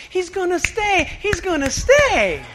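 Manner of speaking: high-pitched, hopeful cheer, stretching out on the repeat; hopeful disciples. Acted. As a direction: act out followers convincing themselves. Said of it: "what would you think if you were them".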